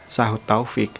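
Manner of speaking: plain reading voice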